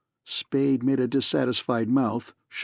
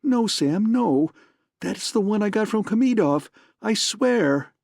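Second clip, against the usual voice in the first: higher pleading cry; frightened woman begging her lover; 8 semitones higher than usual. A narrator's reading. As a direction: plead desperately, voice up high. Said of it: "She cried".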